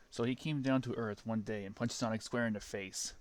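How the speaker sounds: plain reading voice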